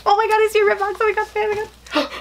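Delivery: real excited high-pitched outburst; freaking out. Real, not acted. Unboxer spotting the red box, freaking out. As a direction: squeal it out, overwhelmed with excitement. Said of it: "I'm freaking out".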